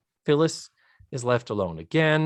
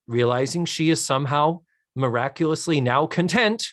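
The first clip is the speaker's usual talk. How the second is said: usual speaking voice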